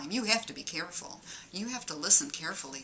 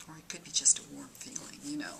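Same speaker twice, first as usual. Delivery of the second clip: softer, fast whispered aside; sly whisper; 5 semitones lower, 6 dB quieter, faster than usual. Acted. Comedian whispering punchline about warm feeling. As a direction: toss it off under the breath.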